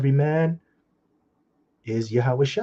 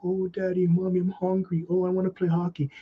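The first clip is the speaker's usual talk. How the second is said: put-on higher whining child voice; whiny kids; 7 semitones higher than usual. Preacher imitating kids begging their father, higher whiny voice. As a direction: beg like a hungry kid. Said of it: "You look at them faces".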